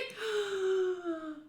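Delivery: high gasp of shock; startled gasp; sharp gasp. Real, not acted. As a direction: suck in air in surprise. Gasps at the realization.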